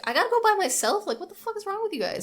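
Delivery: high-pitched mock incredulous protest; squeaky-high imitated disbelief; Matt. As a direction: act out an indignant objection way up high. Voicing Matt's protest at being sent.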